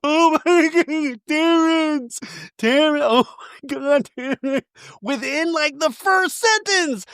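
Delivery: high, slow squealing disbelief. Real, not acted. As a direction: squeal each word in disbelief. Reacting in disbelief.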